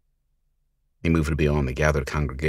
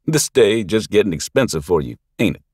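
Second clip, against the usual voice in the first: mocking thug; 5 semitones higher, 6 dB louder than usual. Thug taunting the girl.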